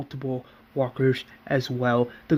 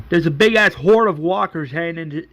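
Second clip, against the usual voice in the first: louder, quick-fire warning; urgent report; 2 semitones higher, 6 dB louder, faster than usual. Retold or imitated. Lookout warns the governor of a horde, urgent.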